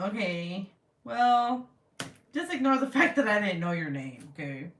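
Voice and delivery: usual speaking voice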